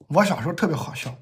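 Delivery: ordinary delivery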